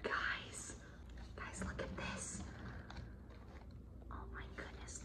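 soft, breath-only whisper; hushed amazement; awestruck whisper. Real, not acted. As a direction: whisper to viewers as if stunned. Overwhelmed, showing the halter.

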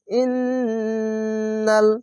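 ইন্নাল chanted with a long hummed n; held nasal ghunnah. Shown as a demonstration. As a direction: hold the doubled n of ইন্নাল through the nose. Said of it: "এখানে ওয়াজিব গুন্না".

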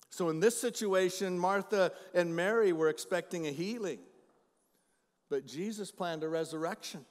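loud, ringing pulpit preaching; booming Sunday sermon voice; full-force preaching. Acted. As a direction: preach it out to the whole room. Preaching to the congregation.

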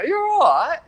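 very high, loud English greeting, rising; imitated English accent. Lifeguard imitating someone else's greeting in England, English accent. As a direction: mimic another Newquay local's high-pitched greeting. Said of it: "It's strange for us over here to be getting the accent".

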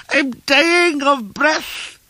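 very high, slow loud bleat; piercing breathless old man's lament; choking goat-like cry. A narrator's reading. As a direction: wail it in a drawn-out strangled falsetto. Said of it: "he cried".

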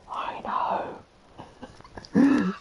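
imitated whisper. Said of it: "Just randomly got you going".

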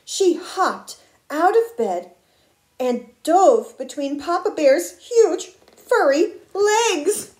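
emphatic read-aloud with punched words; dramatic picture-book telling; suspenseful story narration. A narrator's reading. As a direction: build suspense for young listeners.

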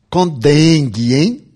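an inner voice nudging with a wink; little inner voice. Retold or imitated. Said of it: "uma vozinha me disse assim".

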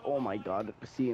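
ordinary talk, nothing marked

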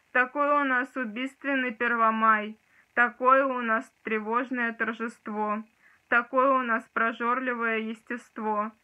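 intense poetry reading; dramatic poetry declamation. Acted. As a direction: declaim it with rising force. Recites second poem.

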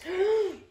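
loud high gasp for the little ones; mock gasp. Teacher turning to the scary page, playful gasp. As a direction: gasp as if something scary is coming.

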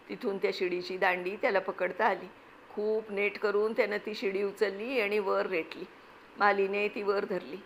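ordinary delivery, nothing marked

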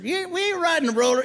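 high mock dad voice; dad refusing. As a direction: squeak out a flat no to the kids.

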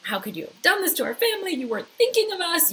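high-pitched, hurried accusation; imitated accusing wail; mock blaming. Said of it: "not blaming him".

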